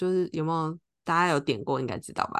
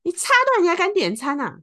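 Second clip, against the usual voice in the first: high, loud imitated roar at her; angry yell; 13 semitones higher, 6 dB louder than usual. Stranger in the car behind yelling at her, loud angry retold shout. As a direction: bark it like an enraged stranger. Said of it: "对我咆哮".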